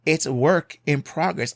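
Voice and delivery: ordinary delivery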